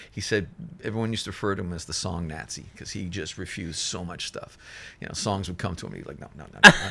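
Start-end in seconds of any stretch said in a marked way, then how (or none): 6.2-6.9 high, loud rapid-fire refusal; mock refusal. Engineer turning down songs offered to him, mock refusal. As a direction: imitate someone turning everything down, pitched high and loud.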